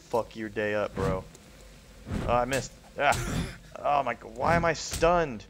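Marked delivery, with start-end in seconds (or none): 2.3-5.4 loud, higher angry yelling; raging gamer. Real, not acted. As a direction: rage at the game. Raging at zombie fight.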